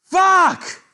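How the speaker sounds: high, loud anguished shout of fuck; yelled curse. Real, not acted. Streamer howling as his rook falls, loud anguished yell. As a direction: scream it at the screen. Losing rook in chess game.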